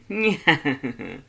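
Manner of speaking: a loud gleeful cackle. Acted. Papyrus on the phone, cackling loudly. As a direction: throw out a triumphant laugh. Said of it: "then he cackles".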